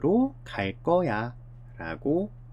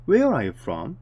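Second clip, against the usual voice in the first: Where punched louder as a question; emphatic question; stress on where; 5 dB louder, much slower than usual. Shown as a demonstration. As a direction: lean on Where. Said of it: "강조하면서 어디에서 왔니를 물어보죠".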